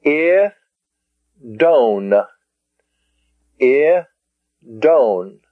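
"I, don" split in two with a long gap; syllable by syllable. Shown as a demonstration. Teacher pronouncing the next Greek word "I, don", syllable by syllable. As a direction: say "I", wait, then a drawn-out "don". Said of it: "The next word".

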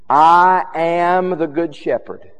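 slow, loud proclamation; Jesus's claims. Acted. Preacher quoting Jesus's claims to the congregation, loud slow proclamation. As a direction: stretch the opening words and ring them out. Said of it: "let me give you some of the claims".

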